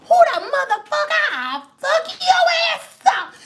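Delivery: very high, hammed-up shouting; imitated screechy outburst of rage; mock furious threat. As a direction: play a grandma ready to fight. Grandma threatening to fight, retold.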